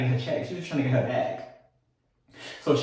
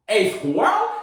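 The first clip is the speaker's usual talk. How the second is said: higher, rising disbelief; incredulous repeat; 6 semitones higher than usual. Real, not acted. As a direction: say it like you can't believe it.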